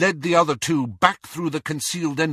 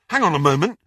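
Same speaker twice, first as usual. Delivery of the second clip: sharp; 5 semitones higher, 5 dB louder, much faster than usual. A narrator's reading. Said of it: "said Ron sharply".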